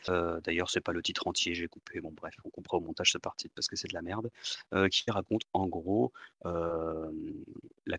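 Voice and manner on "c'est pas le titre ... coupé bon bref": ordinary talk, nothing marked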